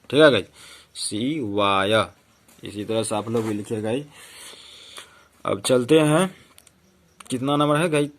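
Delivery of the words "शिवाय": शिवाय said slowly, each syllable drawn out; slow model reading. Shown as a demonstration. Teacher modelling the word शिवाय slowly for the class. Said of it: "अंतिम विसर्ग तो एएच हो गया ना".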